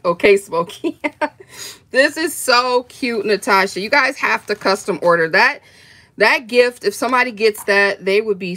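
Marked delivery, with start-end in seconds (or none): none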